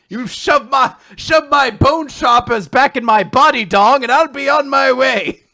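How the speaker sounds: put-on high, loud, indignant old-timer; hammed-up cranky codger rant; stubborn old man. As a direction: shout them off with pig-headed pride. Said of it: "he's a very stubborn old man".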